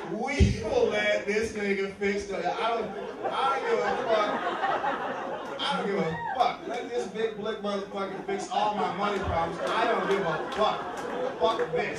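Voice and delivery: loud, fed-up rant; a hint of fed-up rural voter; exasperated voter. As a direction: throw up your hands, don't care anymore. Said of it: "former slaveholders in fucking Pennsylvania was like".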